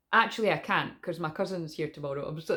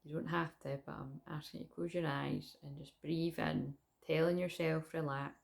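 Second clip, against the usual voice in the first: guided meditation; 6 dB quieter than usual. Acted. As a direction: guide them calmly into the breath. Leads a breathing meditation.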